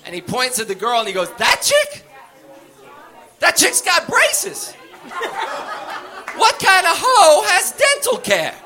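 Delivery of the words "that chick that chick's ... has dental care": much higher, slow, jeering; mocking high voice. Arrested man mocks the undercover cop, high and jeering. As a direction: jeer at her in a high put-on voice.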